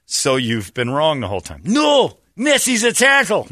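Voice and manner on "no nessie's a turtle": high, loud protest; hammed-up indignant objection; mock outrage. Mocking someone defending Nessie, high mock-outraged cry.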